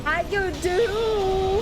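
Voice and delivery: high, long drawn-out coo; playful greeting. Pretend. Streamer greeting a subscriber, playful high drawl.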